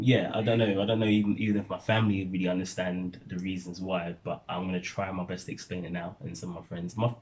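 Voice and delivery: usual speaking voice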